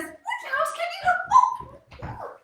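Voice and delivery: very high, drawn-out sweet bee voice; bee's nicest buzz. Acted. Bee gently asks the shy woodlouse for help, in a very high nicest buzz. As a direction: coax in a high, buzzy little voice. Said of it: "in his nicest buzz".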